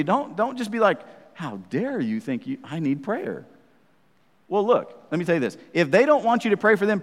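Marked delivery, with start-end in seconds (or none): 1.3-3.5 low, slow affronted reply; slow-burning mock outrage; mock indignation. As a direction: act gravely offended, hammed-up. Acting out offended reply to offer.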